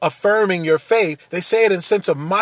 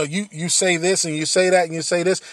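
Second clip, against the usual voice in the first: ordinary talk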